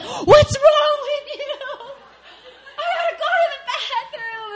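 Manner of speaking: hammed-up furious desperation with a laugh in it; mock angry outburst. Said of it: "getting more and more angry".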